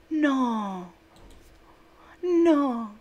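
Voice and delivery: hammed-up loud groan of no; loud mock refusal. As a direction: refuse it in comic despair. Refusing letter loudly.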